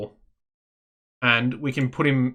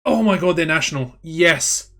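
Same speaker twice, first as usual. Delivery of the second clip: higher-pitched burst of excitement; real thrilled disbelief, landing on a triumphant yes; freaking out; 4 semitones higher, 3 dB louder than usual. Real, not acted. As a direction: exclaim it like a wish just came true. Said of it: "I'm freaking out".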